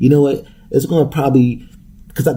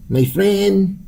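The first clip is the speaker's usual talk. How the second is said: a much higher, put-on caricature voice; 11 semitones higher than usual. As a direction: ham up a cartoonish greeting. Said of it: "That's a stereotypical voice".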